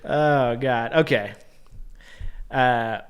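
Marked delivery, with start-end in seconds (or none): none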